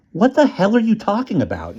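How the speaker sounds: high, exasperated outburst; baffled friend. Acted. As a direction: blurt it out confused.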